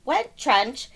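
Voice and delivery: ordinary delivery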